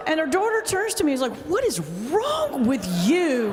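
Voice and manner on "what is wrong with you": slow, higher-pitched mock indignation; appalled scolding. Daughter to pastor at her mother's funeral, mock appalled scolding. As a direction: draw each word out, acting appalled.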